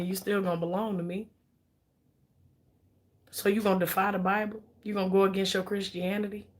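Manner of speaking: low, soft, coaxing husband guilt-tripping his wife; husband's low manipulative voice. Retold or imitated. Husband pressuring his wife to stay, low and soft coaxing. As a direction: murmur it low like a controlling husband quoting scripture.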